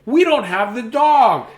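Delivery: put-on high, loud, slow child's voice; eager child. A narrator's reading. Matthew tells his dad, high child voice. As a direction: announce it like a pleased kid.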